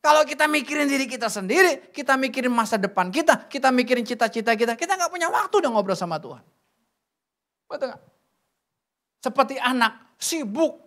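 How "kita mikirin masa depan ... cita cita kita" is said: usual speaking voice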